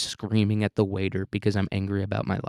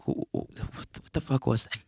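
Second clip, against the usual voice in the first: breathless, much softer stammering shock; stunned disbelief; 2 semitones higher, 5 dB quieter than usual. Retold or imitated. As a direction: gasp at your own post. Stunned at own past post.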